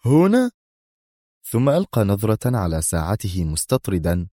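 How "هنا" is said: high rising query; jolted awake, asking in surprise; sharply lifted questioning voice; startled question. A narrator's reading. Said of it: "وهو يتساءل".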